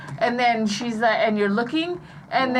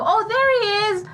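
higher-pitched mock "found him" cry; pretend discovery; 10 semitones higher than usual. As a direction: play at suddenly spotting someone. Coaching child's acted discovery of dad.

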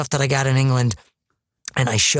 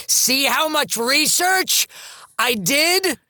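very high, drawn-out mock declaiming; hammed-up showing off; 11 semitones higher, slower than usual. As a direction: plead for attention in a stretched, high ham voice. Mocks own showy acting onstage.

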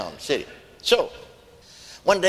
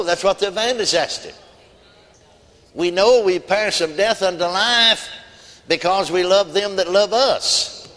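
raised, emphatic pulpit delivery; higher-pitched preaching with weighty pauses; forceful preaching; 5 semitones higher, 3 dB louder than usual. Acted. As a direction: drive the point home to the congregation.

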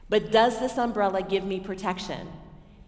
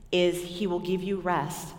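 ordinary talk

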